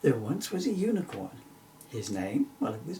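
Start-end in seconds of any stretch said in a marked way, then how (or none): none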